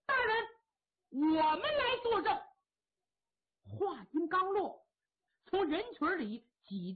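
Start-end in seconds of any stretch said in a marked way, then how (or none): 0.0-2.4 high, loud call from the back of the hall; loud shout from the crowd. Acted. Witness calling out to the judge from the crowd, loud shout. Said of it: "有人高喊".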